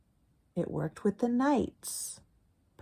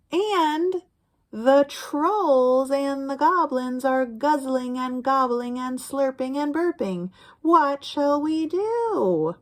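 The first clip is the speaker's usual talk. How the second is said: higher, louder sing-song read-aloud; higher, slower storytime chant; reading to children; 7 semitones higher, 7 dB louder, slower than usual. Acted. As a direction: chant the refrain for little listeners. Reading rhyming picture book aloud.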